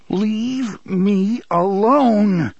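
very slow, loud, menacing; cold warning. Acted. Son warning his cowed father, menacing. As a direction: let each word burn. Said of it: "Jake said".

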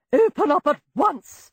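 high angry screech. A narrator's reading. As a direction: screech at the door. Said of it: "screeched the other".

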